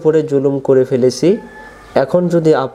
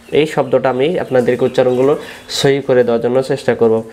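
usual speaking voice

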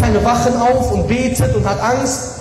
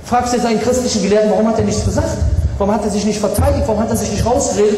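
ordinary talk

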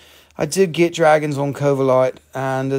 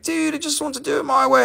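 whiny carver; 12 semitones higher, faster than usual. Retold or imitated. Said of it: "And he's like".

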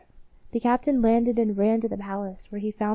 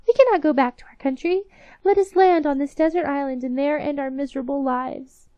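despairing mice; 6 semitones higher, 6 dB louder than usual. Acted. Mice lament lost ring.

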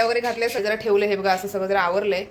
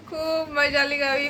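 laughs, then says it slowly and higher; chuckling while remembering the fun trip; laughing; 6 semitones higher, slower than usual. Real, not acted. Telling viewers about the village trip, laughing.

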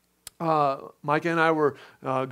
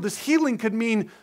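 normal talking voice

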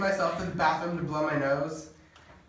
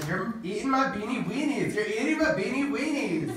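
mock yell; 6 semitones higher than usual.

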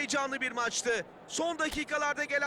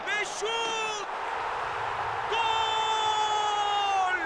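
yelled goal call, pitch way up; screaming football commentator; 6 semitones higher, 3 dB louder, much slower than usual. Acted. Goal scored in game commentary. (gol anında bağıran spiker)

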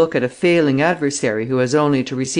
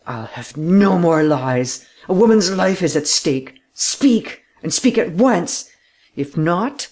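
furious demand; 4 semitones higher, slower than usual. A narrator's reading. Rénine gripping the official's throat, furious demand. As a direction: force the truth out of him. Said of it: "so much infuriated".

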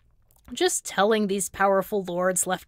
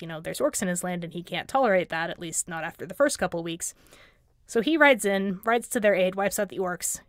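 normal talking voice

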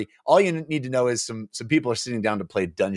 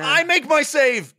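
high, slow barked claim; 4 dB louder, slower than usual. Acted. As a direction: bark the save. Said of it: "barked Lefty Limb".

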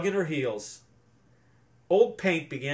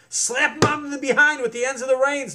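loud high instruction; directing; 10 semitones higher, 6 dB louder than usual. A narrator's reading. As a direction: shout the order across the water. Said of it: "directed Austin".

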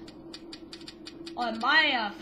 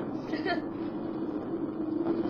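laughing; 5 semitones higher than usual. Real, not acted. Teasing viewers about editing.

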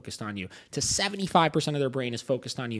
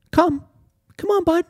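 very high, loud coaxing squeak to a scared dog; a mocking sing-song falsetto for a frightened pup; Mickey Mouse voice; 5 dB louder than usual. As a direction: coo at the dog like a cartoon mouse. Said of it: "give them the Mickey Mouse voice".

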